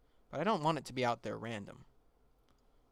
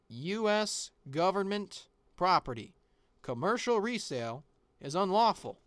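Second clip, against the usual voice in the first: official label reading; 6 semitones higher, 5 dB louder than usual. Acted. Reading label aloud formally.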